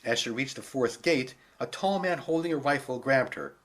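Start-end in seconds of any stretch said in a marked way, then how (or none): none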